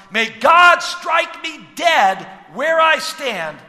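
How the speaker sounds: high, loud, drawn-out oath; sworn oath. Acted. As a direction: call down a curse on yourself, loudly and slowly. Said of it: "saying something along these lines".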